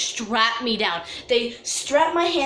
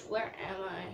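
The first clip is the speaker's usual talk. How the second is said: groggy waking voice; 4 semitones lower, 14 dB quieter than usual. Retold or imitated. Retells waking up disoriented.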